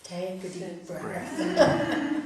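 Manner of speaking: slowly, drawn out, breathing in; slow deep breath. Shown as a demonstration. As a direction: show the breath, unhurried. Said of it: "You know what it is?".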